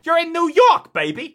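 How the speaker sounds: high, loud "You're in New York"; hammed-up cheer. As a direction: belt it like a tourist. Exclamation to self.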